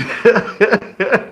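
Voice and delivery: real amused laughter. Real, not acted. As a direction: laugh helplessly mid-sentence. Amused by the retold clash.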